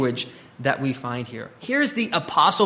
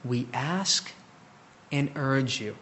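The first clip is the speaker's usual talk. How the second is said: soft, gentle asking, a touch quieter; very tenderly; 4 semitones lower, 7 dB quieter, slower than usual. Retold or imitated. Pastor voicing Paul gently urging the believers, tender. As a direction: ask it gently, like a loving father. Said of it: "very tenderly though".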